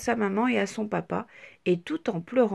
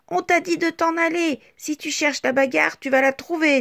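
higher, louder menace; threatening; 6 semitones higher, 7 dB louder than usual. A narrator's reading. Petit Triangle threatens Petite Tache, loud menace. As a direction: threaten a fight. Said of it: "menaçant".

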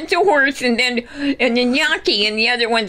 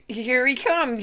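ordinary delivery

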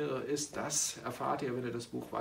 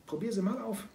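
lightly voiced father; 9 semitones higher than usual. Father encourages daughter.

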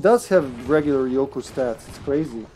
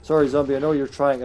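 ordinary talk

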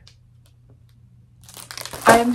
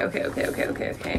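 whispering okay over and over to calm down; whispered okays; 9 dB quieter, much faster than usual. Real, not acted. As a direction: breathe out each okay in a whisper. Calming herself down.

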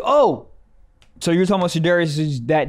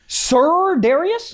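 very high, stretched-out questioning; incredulous; 11 semitones higher, much slower than usual. Real, not acted. Co-host questioning the director's name, incredulous. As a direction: draw the name out high in disbelief.

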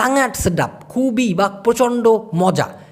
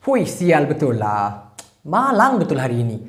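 Malay example phrase; 4 semitones lower than usual. Shown as a demonstration. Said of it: "তিন নম্বরে রয়েছে শিয়াল".

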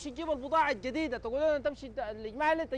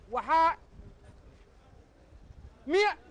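from the oath into a higher, louder burst; outraged burst; 6 semitones higher, 3 dB louder, slower than usual. Acted. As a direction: blurt out the percentage in outrage. Customer bursts out over the price hike. (انفجار غاضب بصوت أعلى)

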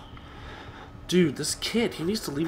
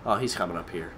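normal talking voice

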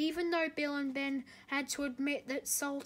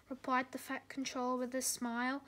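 plain reading voice

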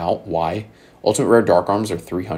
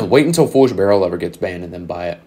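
normal talking voice

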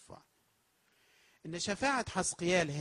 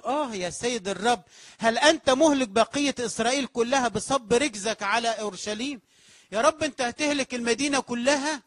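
anguished plea; 7 semitones higher, 8 dB louder than usual. Acted. Ezekiel interceding with the Lord for Jerusalem, anguished loud plea. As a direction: cry out louder and higher to the Lord. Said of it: "بيقول له".

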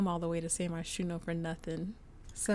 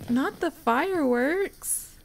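amused squeal; 6 semitones higher, 7 dB louder, slower than usual. Real, not acted. Reacting to Sims woohoo animation.

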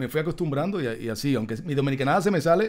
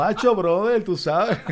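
imitating a Puerto Rican accent, higher and louder; 5 semitones higher, 4 dB louder, slower than usual. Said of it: "yo llegué a Miami hablando boricua".

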